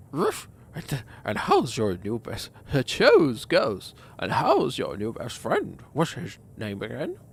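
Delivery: exaggerated sing-song with a ruff; talking dog voice. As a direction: draw it out in a playful put-on pet voice. Talks dog-like, cooing at pet.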